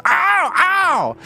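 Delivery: high, loud monster yelp; hurt creature. Acted. Creature that snapped something in its body, high pained yelp. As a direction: cry out in a creature's pain. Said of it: "snaps something in its body and goes".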